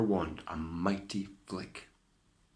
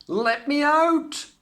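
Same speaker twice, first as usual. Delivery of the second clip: high, loud, slow plea; bellowed; 9 dB louder, slower than usual. A narrator's reading. Cinderella shouts from the locked cellar. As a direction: drag out the cry to be let out. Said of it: "She bellowed".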